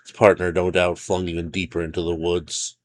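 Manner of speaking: ordinary delivery, nothing marked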